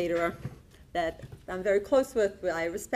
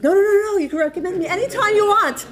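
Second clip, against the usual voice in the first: eager protest; 12 semitones higher, 11 dB louder than usual. Retold or imitated. Caterer protesting, laughing, on phone.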